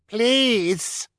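high, loud yelp of a fall; shouting. A narrator's reading. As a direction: shout it while crashing down. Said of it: "he shouted as he hit the ground".

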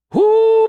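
very high, loud whoo of relief; whoo exclamation. Acted. Skippy recalling channeling the battle cruiser energy, loud high whoo. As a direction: let out a big startled whoo.

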